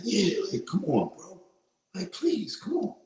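high, pleading mock voice; pleading impression. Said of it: "He's like".

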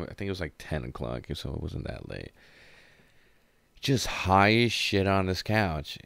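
ordinary talk, nothing marked

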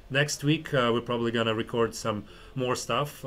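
ordinary talk, nothing marked

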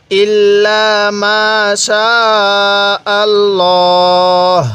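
চ্যালেঞ্জ-free: ইল্লা মা শা then আল্লাহ drawn out slowly and stopped; Quran recitation with a stop. Shown as a demonstration. Teacher showing students how to stop, slow drawn-out recitation. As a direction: recite ইল্লা মা শা আল্লাহ, stretching আল্লাহ long before pausing. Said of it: "আমি কিন্তু এখানে ওয়াকফ করছি".

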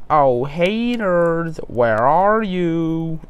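high, slow sing-song; lilting, teasing call. A narrator's reading. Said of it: "said Caillou in a sing-song voice".

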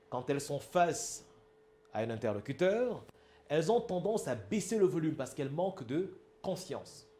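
usual speaking voice

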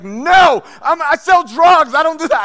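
high, slow, loud yelled denial; outraged shout. Retold or imitated. Dealer yelling at detectives that he sells drugs, loud. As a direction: shout it as an insult to your pride.

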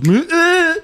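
loud high imitated gut noise; stomach noise. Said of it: "My stomach literally just goes".

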